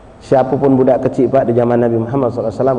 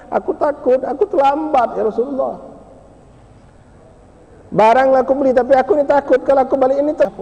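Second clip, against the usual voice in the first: put-on high, scared boy's voice; high voice of a frightened slave boy; frightened child; 13 semitones higher than usual. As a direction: plead your fear of going home late. Boy afraid to go home.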